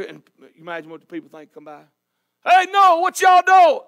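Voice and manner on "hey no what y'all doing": loud, high mocking call; nosy neighbour shouting across the field; mocking passerby. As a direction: holler it at Noah like a heckler. Passing mocker calls to Noah.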